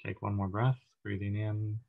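even, unhurried meditation voice with long pauses; calm, steady body-scan narration; guided meditation. Acted. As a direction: guide listeners gently through breath and body, leaving space between phrases.

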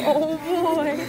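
put-on eager oh boy; gleeful. Shown as a demonstration. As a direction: rub your hands and relish it. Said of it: "rub your hands together and say".

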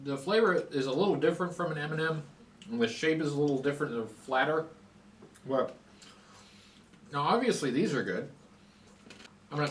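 talking while chewing candy; muffled chewing speech; mouth full, chewing. Real, not acted. Seen 5: Lips closed and working, cheeks full while holding small candy.

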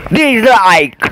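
sudden loud dislike; shouted dislike. Real, not acted. Gamer shouting his verdict on the level, loud and disgusted.

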